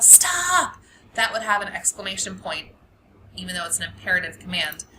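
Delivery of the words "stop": sudden sharp shout, much higher and louder; yell. Shown as a demonstration. Teacher shouting a warning to someone crossing the street. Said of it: "You might yell".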